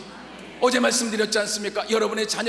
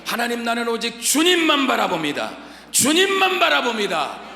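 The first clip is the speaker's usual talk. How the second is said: fervent prayer; 2 semitones higher, 6 dB louder than usual. Shown as a demonstration. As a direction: cry out the confession. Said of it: "이 고백이 나오길 축원합니다".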